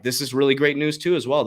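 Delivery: ordinary delivery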